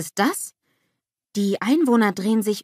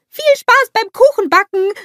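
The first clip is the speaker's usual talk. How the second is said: very high, loud cheery call; calling after someone; 10 dB louder than usual. A narrator's reading. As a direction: shout it after him, pitched way up. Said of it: "ruft Olaf ihm hinterher".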